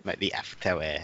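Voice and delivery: soft mock French aside; quiet French commentator voice; French accent. Finishing the commentator bit, soft mock French accent. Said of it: "It's probably my best accent".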